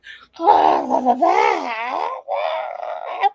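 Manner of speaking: loud, hammed-up fake bawling; long mock wail, high and snotty; snot-crying demo. Shown as a demonstration. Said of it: "when I say snot crying, I say this".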